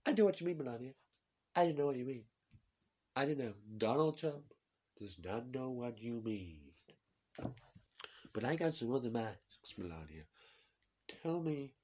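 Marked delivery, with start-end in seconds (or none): 0.1-10.2 put-on higher, bragging Trump accent; Trump impression. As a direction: Trump talks about himself. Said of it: "I can't get out of his accent now".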